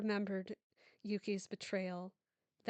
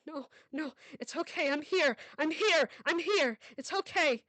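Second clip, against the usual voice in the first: higher, louder pleading comfort; desperate reassurance; 7 semitones higher, 7 dB louder than usual. Acted. Mother desperately reassuring her choking daughter. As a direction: insist she's here, desperately. Mother insisting during crisis.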